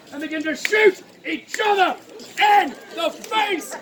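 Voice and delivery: ordinary talk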